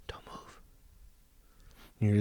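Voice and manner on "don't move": tense whisper; barely audible cautioning whisper; hushed warning. Acted. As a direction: whisper it, keep very still. Warning a child near wildlife.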